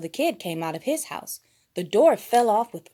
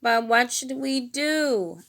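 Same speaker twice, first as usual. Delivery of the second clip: fearful; 5 semitones higher, 4 dB louder, slower than usual. A narrator's reading. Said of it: "asked Fozzie fearfully".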